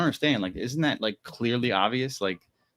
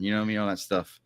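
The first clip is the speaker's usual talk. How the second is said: ordinary talk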